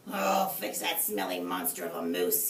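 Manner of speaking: deep, loud vow of revenge; low angry swearing. A narrator's reading. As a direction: growl it low and furious. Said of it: "he swore".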